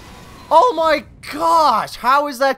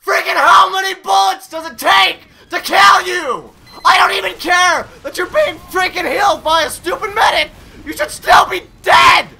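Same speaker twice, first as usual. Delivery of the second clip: higher, louder, breaking tearful yells; furious crying shout; 6 semitones higher, 6 dB louder than usual. Real, not acted. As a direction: scream in desperate rage. Raging, near tears.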